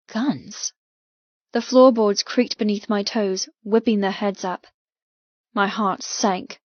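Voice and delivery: ordinary talk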